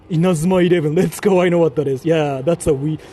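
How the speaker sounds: loud, hyped shout; hyped gamer yell; excited. Real, not acted. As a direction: burst out loud with recognition. Excited at recognized card.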